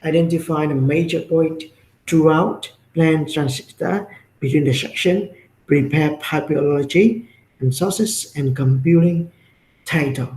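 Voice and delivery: ordinary talk, nothing marked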